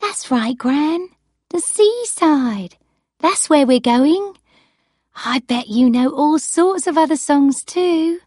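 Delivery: cheerful granddaughter voice; young woman voice. Acted. Cindy voiced by reader.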